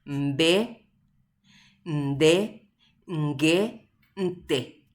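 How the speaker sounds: "Mbe, nde, nge, nte" each syllable said slowly and apart, with the nasal onset sounded before the stop; teacher sounding "Mbe", "nde", "nge", "nte" for the class, nasal onset before each stop; nasal consonant demo. Shown as a demonstration. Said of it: "Ha upea ha'e hína".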